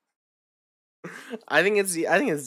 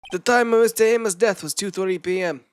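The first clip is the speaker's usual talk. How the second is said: Shakespearean voice; 4 semitones higher, 4 dB louder than usual. Acted. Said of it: "you Shakespearean voice this guy?".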